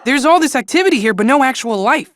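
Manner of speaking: ordinary talk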